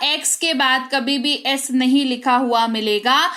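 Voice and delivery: ordinary delivery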